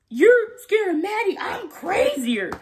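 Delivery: loud, high boast slowing down; hammed-up fake bravado; mock tough Cassie. Vlogger mocking Cassie's tough talk, loud and high.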